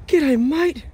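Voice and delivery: high, drawn-out hello to a dog; cheerful high dog-greeting. Real, not acted.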